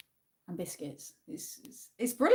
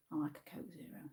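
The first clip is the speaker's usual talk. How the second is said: whisper; 4 semitones lower, 16 dB quieter, faster than usual. Real, not acted. Aside reacting to a chat comment.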